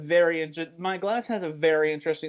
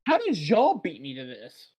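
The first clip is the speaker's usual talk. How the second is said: higher-pitched, incredulous question; retold disbelief; 4 semitones higher, 4 dB louder than usual. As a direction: ask it in baffled mock outrage. Retelling own disbelief at being beaten.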